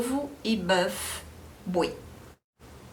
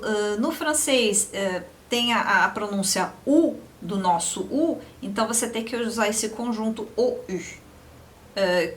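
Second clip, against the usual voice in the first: normal talking voice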